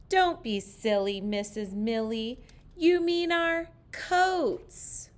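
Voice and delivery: put-on playful kids' scolding, slowed down; children correcting teacher. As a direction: drag it out like a class teasing teacher.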